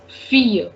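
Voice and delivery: "fear" said fee-yuh, no L; French "ille" sound. Shown as a demonstration. Teacher showing the French for girl, "fear" as fee-yuh. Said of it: "word that you are having with the same sound".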